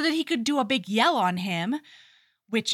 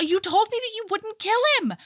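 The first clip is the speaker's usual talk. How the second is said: high-pitched imitated objection; mock protest; 11 semitones higher than usual. Retold heroine reproaching Alec, mock protest. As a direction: object in a raised, indignant voice.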